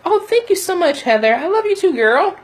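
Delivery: high delighted thanks. Real, not acted. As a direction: gush over the comment. Thanking viewer comment warmly.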